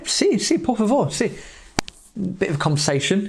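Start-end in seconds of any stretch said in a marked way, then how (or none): 0.1-1.3 high-pitched, eager Spanish reply; quick high yeses in Spanish; eager acceptance. Retold or imitated. Eager reply to coffee offer.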